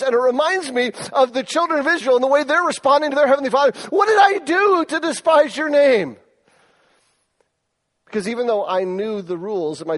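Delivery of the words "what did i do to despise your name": incredulous. Retold or imitated. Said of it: "just being incredulous".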